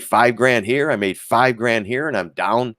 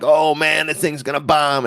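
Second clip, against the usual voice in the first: mock put buyers; 6 semitones higher than usual. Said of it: "they're like".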